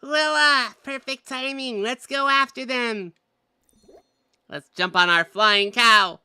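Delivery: high, loud rallying game-hero voice; eager adventurer character. Acted. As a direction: call the party to the chase.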